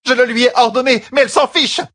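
loud, high-pitched indignant shouting; shrieked complaint about a defiant pupil; enraged. A narrator's reading. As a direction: protest furiously at full voice. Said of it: "rouge de rage".

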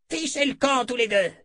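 high-pitched angry outburst; angry cry. A narrator's reading. Sorceress shutting her door on visitors, crying out angrily. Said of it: "Cria la magicienne".

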